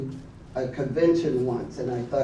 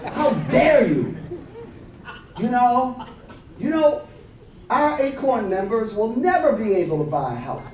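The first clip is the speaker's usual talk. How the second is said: furious phone rant; 6 semitones higher than usual. Retold or imitated. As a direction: tell her off, boiling over. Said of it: "Something snapped".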